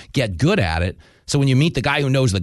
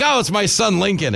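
mock surprise; 9 semitones higher than usual. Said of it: "And I'm like".

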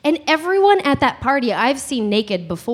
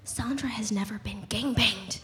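softer hushed gossip whisper; scandalized whisper; 3 semitones lower, 10 dB quieter than usual. Retold or imitated. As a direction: lean in and whisper the secret. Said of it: "and she goes, Daisy".

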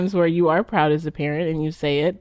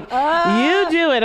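mock bickering husband; 10 semitones higher, 4 dB louder, much slower than usual. Spouses squabbling over who gets fixed.

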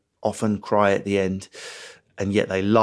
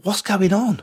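baffled protest; 10 semitones higher than usual. Retold or imitated. His own baffled inner voice.

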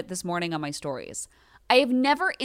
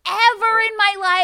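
heated rant; 11 semitones higher, 8 dB louder than usual. Real, not acted.

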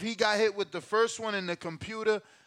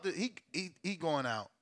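ordinary delivery

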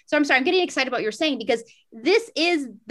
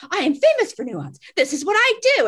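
indignant; 6 semitones higher, 4 dB louder than usual. Acted. Acted indignant inner protest at note.

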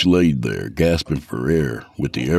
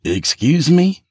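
indignant; 12 semitones higher, 3 dB louder, slower than usual. A narrator's reading. Jeff taken aback.

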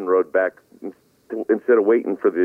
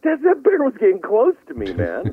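high defensive retort; 11 semitones higher than usual. Retold or imitated. Own retort voiced in story.

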